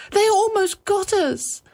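very high-pitched, distressed wail; crying. A narrator's reading. Wife crying to her husband after a narrow escape. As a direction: wail it in high-voiced panic. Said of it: "he was laughing and she was crying".

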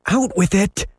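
high impatient demand; shouting. A narrator's reading. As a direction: snap at her to tell him. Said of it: "he shouted".

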